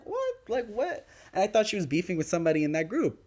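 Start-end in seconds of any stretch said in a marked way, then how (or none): 0.0-1.1 high, rising disbelief; mock stunned double take; retold shock. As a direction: squeak out the what's in shock. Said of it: "So I was like".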